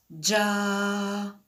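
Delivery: ordinary delivery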